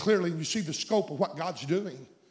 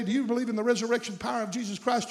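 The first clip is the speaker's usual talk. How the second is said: ordinary talk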